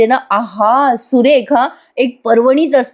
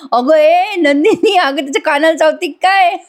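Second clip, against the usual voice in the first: mock yell; 6 semitones higher than usual. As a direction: imitate an uncle calling out in alarm, half laughing. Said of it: "मिथुन काका जोरात ओरडतात". (हसत हसत ओरडल्याची नक्कल)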